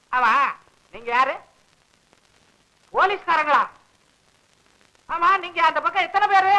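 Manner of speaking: ordinary talk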